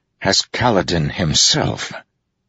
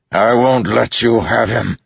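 raised, higher defiant bellow; 6 semitones higher, 3 dB louder than usual. A narrator's reading. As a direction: bellow it to stop an attacker. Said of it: "Dalinar bellowed".

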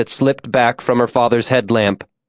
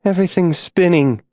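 higher-pitched, understated mumble; dazed mumble; 6 semitones higher than usual. A narrator's reading. Dying June lies on the floor, dazed high mumble. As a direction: murmur it as if fading out. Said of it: "she mumbled".

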